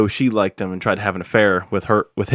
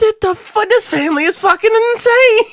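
high loud disbelief; high, loud astonished rant; incredulous outburst; 6 dB louder than usual. Retold or imitated. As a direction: exclaim in shock. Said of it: "I'm like".

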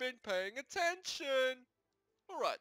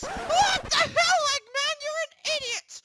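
high, loud cartoon shout; pitched-up mock outrage; indignant yell; 12 dB louder than usual. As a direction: yell back in a squeaky character voice. Link yells back at Eggman.

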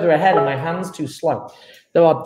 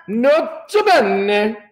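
slow, drawn-out Italian accent; playful Italian-accented flourish; 2 semitones higher, much slower than usual. Shown as a demonstration. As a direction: stretch it out like a mock Italian. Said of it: "Gotta say it with an Italian accent".

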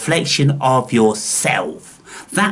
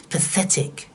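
ordinary talk, nothing marked

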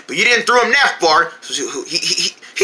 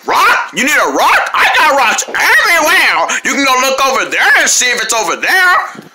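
loud flamingo lady; 3 semitones higher than usual. Acted. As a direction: shout the offer like a pushy bird lady. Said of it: "she is loud".

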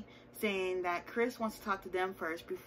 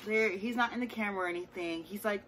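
normal talking voice

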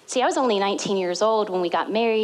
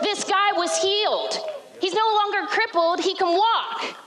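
high, forceful, daring challenge; bold defiance; 8 semitones higher than usual. Acted. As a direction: throw it in the council's face, pitched up high. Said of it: "Oh, listen to the boldness of him".

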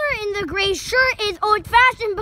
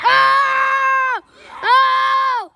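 excited shout; 6 semitones higher, 6 dB louder than usual. Real, not acted. Goal cheer in backyard.

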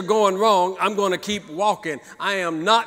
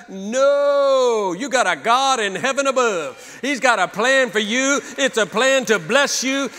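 rising, louder preacher cadence; pounding sermon refrain, ending in a shout; preaching at full force; 5 semitones higher, 4 dB louder than usual. Acted. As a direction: build it up like a pulpit climax. Preaching about God's plan.

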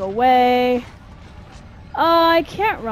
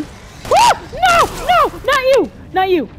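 panicked screams; 13 semitones higher, 6 dB louder than usual. Real, not acted.

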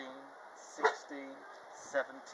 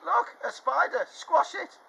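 very high, loud scream; 16 dB louder, slower than usual. Acted. Child spotting the spider, screaming high. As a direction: shriek it in panic. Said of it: "screamed someone".

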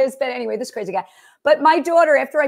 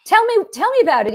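eager retelling; 7 semitones higher, 3 dB louder, faster than usual. Retold or imitated. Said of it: "He goes".

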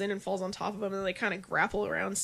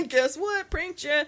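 prank caller; 7 semitones higher, 5 dB louder, slower than usual. Retold or imitated. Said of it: "And then it's like".

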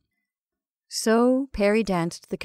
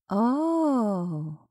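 casual, drawn-out oh. A narrator's reading. Betty hears of the visiting architect, casual. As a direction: toss it off casually. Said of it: "she said casually".

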